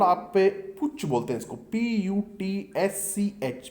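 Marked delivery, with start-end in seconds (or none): 1.6-3.7 "पी यू टी एस सी एच" spelled slowly; spelling out letters. Shown as a demonstration. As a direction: read out "पी यू टी एस सी एच" letter by letter. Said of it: "इसका सही pronunciation होता है". ("पी यू टी एस सी एच" धीरे-धीरे अक्षर-अक्षर)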